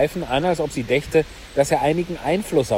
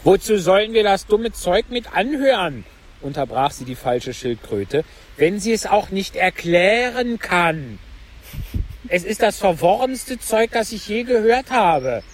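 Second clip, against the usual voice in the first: irritated complaint; 7 semitones higher than usual. Acted. Mock Turtle complains irritably.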